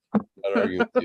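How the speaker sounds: squeaky high put-on voice; high mock voice. Dad conceding the rant in a squeaky joke voice. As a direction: back off in a silly high voice.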